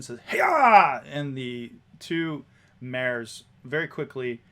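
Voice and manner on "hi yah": high, loud shout to horses; driver's cry. Acted. Driver flicks the reins, shouting to the mares. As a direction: urge the team on with a yell. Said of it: "she flicks the reins once again".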